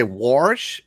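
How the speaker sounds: "warsh" with an added r, rising and loud; dialect WARSH. Shown as a demonstration. Host shows friends a dialect word, "warsh" with an r. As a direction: say "warsh" with an r before the sh. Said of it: "you guys are talking about dialect".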